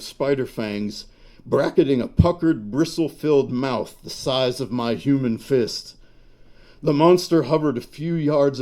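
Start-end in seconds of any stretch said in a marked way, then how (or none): none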